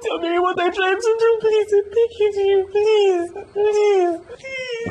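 high, loud, sobbing please; crying plea. Acted. Woman begging Jonas for forgiveness, crying. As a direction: beg through tears.

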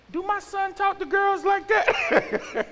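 very high, slow squeal of dismay; mock alarmed parent. Father wondering about his son, high mock-dismayed question. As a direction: draw it out in high-pitched disbelief.

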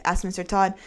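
ordinary delivery

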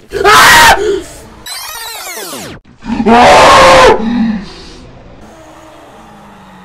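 very high, very loud screams of terror; real shrieking fright. Real, not acted. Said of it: "I screamed like a motherfucking girl".

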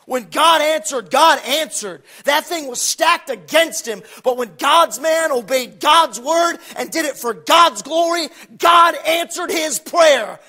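loud, ringing preacher's proclamation; fiery preaching. Real, not acted. Preacher proclaiming God's answer to the congregation, loud. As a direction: drive it home to the pews.

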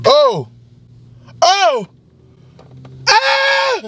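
high rising fake yelps of pain; fake pained cries. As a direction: yelp as if just shot. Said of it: "that's me practicing".

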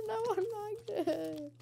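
slow high jeer through laughter; laughing taunt. Acted. Gamer laughing at a mob while taunting. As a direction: laugh it out at them.

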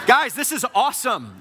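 high, loud, thrilled; excited outburst. Retold or imitated. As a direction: burst out with delight. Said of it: "Lindsay and I are freaking out".

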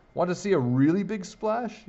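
high, loud boastful yell. A narrator's reading. Boy about to outdo his friend's dive, yelling. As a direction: yell it as an eager challenge. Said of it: "yelled Bobby".

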